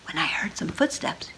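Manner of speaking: hushed whisper. Acted. Telling of night footsteps in a hushed whisper. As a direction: breathe it like a secret at night.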